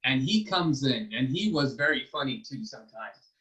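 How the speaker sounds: ordinary delivery, nothing marked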